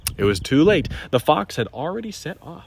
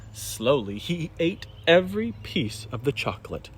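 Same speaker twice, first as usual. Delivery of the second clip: plain reading voice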